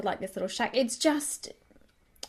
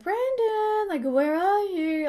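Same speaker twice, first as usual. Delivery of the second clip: high, drawn-out singsong call; mock call for someone in another room; 9 semitones higher, much slower than usual. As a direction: stretch it out high, searching for him. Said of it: "Like, calling out for him".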